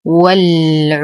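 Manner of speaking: والعدوان with the د bounced in qalqala; tajweed recitation. Shown as a demonstration. Teacher demonstrates qalqala on the word, measured recitation. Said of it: "نقلقل الدال".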